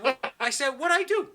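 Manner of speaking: high, loud indignant question; mock baffled protest. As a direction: protest innocence to an accusing friend. Acted baffled reply to "I hate you.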